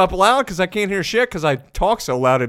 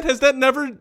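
ordinary delivery

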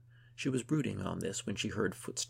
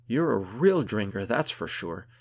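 loud, thrilled compliment; excited praise; 2 semitones higher, 10 dB louder than usual. A narrator's reading. Gunhild praises her friend after the fight, excitedly.

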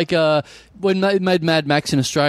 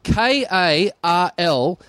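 slow, separated letters; spelling out slowly; 2 semitones higher, much slower than usual. Retold or imitated. Customer correcting a counter worker, slow deliberate spelling. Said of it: "And I'm like".

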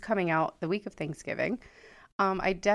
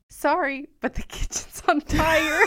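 polite announcement; 7 semitones higher, 6 dB louder than usual. Retold or imitated. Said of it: "Only then did I politely say".

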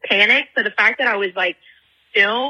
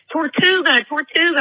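higher-pitched mock sighting call; excited call-out; 7 semitones higher than usual. Boyfriend spotting a sea turtle from the boat, excited.

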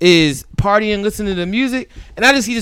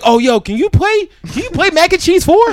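higher-pitched mock request, climbing at the end; pestering party guest; 10 semitones higher than usual. Party guest begging the host for a song, mock-eager.

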